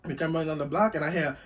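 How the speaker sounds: normal talking voice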